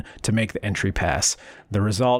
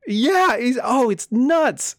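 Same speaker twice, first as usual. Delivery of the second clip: high, loud, giggling through the words; cracking up at how absurd it is; incredulous laughing outburst; laughing disbelief; 13 semitones higher, 5 dB louder, slower than usual. Real, not acted.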